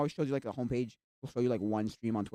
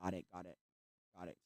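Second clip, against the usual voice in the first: mother humoring; 7 semitones lower, 15 dB quieter than usual. Retold or imitated. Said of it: "She looked at me like".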